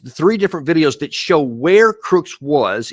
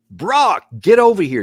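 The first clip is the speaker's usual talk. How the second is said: imitated yell; 6 semitones higher than usual. Commentator mimicking a bystander yelling for Brock, mock shout. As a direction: call out the name like someone yelling in the chaos. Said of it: "you hear Brock one more time screaming out".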